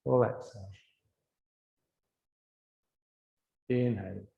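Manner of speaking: low, soft cues with long silences between; guided breathing meditation. Real, not acted. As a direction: calmly guide each breath, letting the words fade. Leads a breathing exercise.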